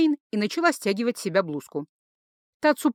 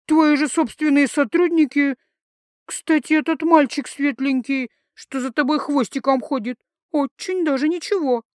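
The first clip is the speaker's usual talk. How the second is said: put-on higher, louder tipsy girl voice; drunk teasing; 5 semitones higher, 4 dB louder than usual. Drunk Lisi teases Jake, high and loud.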